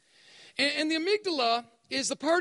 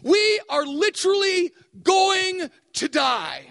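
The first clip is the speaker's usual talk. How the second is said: slow, loud, higher panicked cry of doom; panicked shout; 5 semitones higher, 9 dB louder, much slower than usual. Retold or imitated. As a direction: shout it like you're sure you're sinking. Panicked kayak fear quote.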